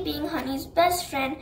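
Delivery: ordinary delivery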